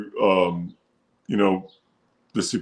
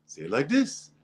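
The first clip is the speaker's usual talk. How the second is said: higher, softer repeat, lifted at the end; coaching producer; 4 semitones higher, 7 dB quieter than usual. Retold or imitated. Producer coaching ads line by line, higher imitated voice. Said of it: "Line by line".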